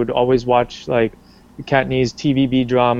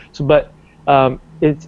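ordinary talk

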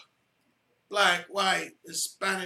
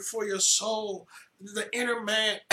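usual speaking voice

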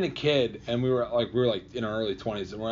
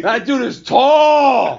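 high, loud, drawn-out exclamation; big high-pitched holler of amazement; amazed shout; 12 semitones higher, 13 dB louder, much slower than usual. Real, not acted. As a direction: shout it, stretching the word out. Exclaiming over a very tall friend.

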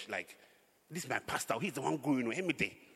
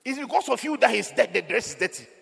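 preaching rebuke; 8 semitones higher, 9 dB louder than usual. Real, not acted. Preacher rebuking disloyal members.

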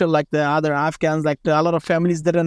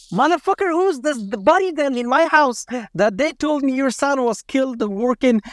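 pitched-up, outraged retold curse; a father exploding at the lie about his son's death; furious disbelief; 6 semitones higher than usual. Father learns son alive, furious.

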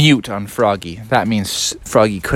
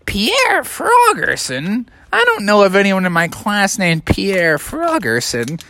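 suspicious teacher; 6 semitones higher than usual. Acted. Teacher to an unknown pupil, suspicious high-pitched questioning. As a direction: query it in puzzled disbelief.

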